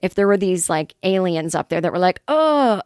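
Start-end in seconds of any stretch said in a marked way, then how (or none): 2.3-2.8 mock French alien. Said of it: "they're like French aliens".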